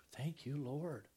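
hushed, grateful whisper; whispered thanks. Real, not acted. Preacher thanking God for his place in the kingdom, grateful whisper. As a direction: whisper a private prayer of relief.

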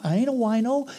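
high, loud indignant dude voice; defensive stoner. Acted. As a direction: protest like an offended buddy. Buddy objects.

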